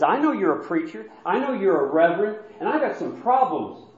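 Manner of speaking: mock angry shout of an upset neighbour; retold angry yelling. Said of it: "And he's yelling".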